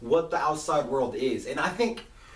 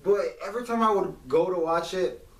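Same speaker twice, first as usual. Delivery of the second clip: ordinary talk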